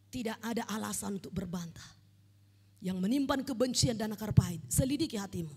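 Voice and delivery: normal talking voice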